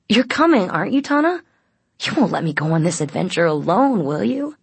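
high, swooping, coaxing questions; wheedling plea. A narrator's reading. Aiden begging Tana to join the adventure, wheedling. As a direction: coax her, pitch leaping on each question.

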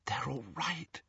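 soft relieved whisper. A narrator's reading. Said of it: "she whispered".

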